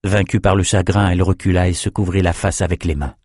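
ordinary delivery, nothing marked

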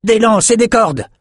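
high, loud shout; excited sailor shouting. A narrator's reading. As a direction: call out across the deck. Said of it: "cria l'un d'eux".

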